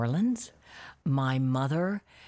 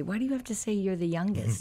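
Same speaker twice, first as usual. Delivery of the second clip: retold sisters' complaint; 6 semitones higher, faster than usual. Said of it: "my sisters always say".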